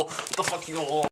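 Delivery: ordinary delivery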